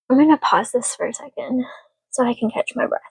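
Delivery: ordinary delivery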